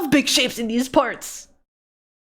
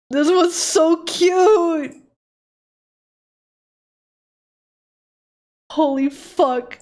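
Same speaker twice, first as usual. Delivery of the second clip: high, slow, teary gushing; real tearful joy at a gift; happy crying; 8 semitones higher, much slower than usual. Real, not acted. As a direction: sob out the praise, overwhelmed. Gushing over fan art.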